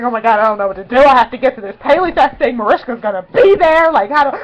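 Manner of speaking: loud, panicked rush; freaking out. Retold or imitated. Fan desperate to reach the event, loud panic. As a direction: spill it in a flustered panic. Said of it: "freaking out".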